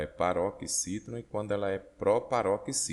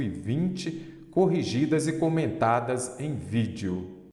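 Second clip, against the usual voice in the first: louder, higher announcer delivery; projected, raised promo voice; promotional ad read; 5 semitones higher, 5 dB louder than usual. Acted. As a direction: pitch the e-books like an ad, drawing out the ending. Reads promo for e-books.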